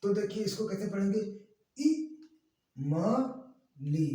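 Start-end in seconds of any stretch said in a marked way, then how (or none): 1.8-4.1 clipped syllables, one at a time; pulled short-i reading. Shown as a demonstration. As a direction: say ई, म, ली as separate short beats. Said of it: "खींच करके".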